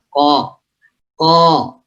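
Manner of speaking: "ko" from the very back of the tongue; thick qaf. Shown as a demonstration. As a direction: say each "ko" heavy and separate. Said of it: "ya begitu".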